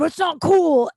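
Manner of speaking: high, loud mock yell; retold yelling. Customer ranting at the bartender about masks, mock yelling. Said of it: "starts yelling at me".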